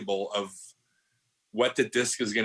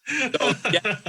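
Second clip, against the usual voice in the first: laughing through a quick so yeah; 9 semitones higher, 3 dB louder than usual. Real, not acted. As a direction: still chuckling as he wraps up. Laughing after the reveal.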